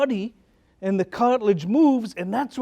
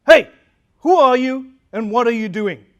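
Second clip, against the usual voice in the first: loud, abrupt sentry challenge; soldier's challenge; 8 dB louder than usual. Retold or imitated. Roman soldier stopping a passing man, challenging him loudly. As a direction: call out like a soldier stopping someone. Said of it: "the soldier says".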